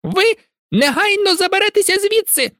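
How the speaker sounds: very high, stern elf voice; angry house-elf. A narrator's reading. Dobby ordering Malfoy away, angry. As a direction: command him off fiercely. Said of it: "гнівно звелів він".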